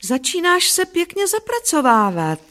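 high, patronizingly kind praise; sing-song encouragement; kindly. A narrator's reading. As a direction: praise a beginner indulgently, pitch lifted. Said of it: "řekl Bob laskavě".

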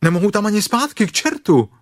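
high, fast, loud panicky complaint; stuck and frantic. A narrator's reading. Pooh stuck in the rabbit hole, frantic and loud. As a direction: blurt it out in a rush, stuck fast.

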